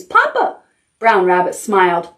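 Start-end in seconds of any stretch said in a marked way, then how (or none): 0.0-0.6 high-pitched duckling squeal; crying out. A narrator's reading. Ducklings renaming Brown Rabbit, very high cry. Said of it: "cried the ducklings".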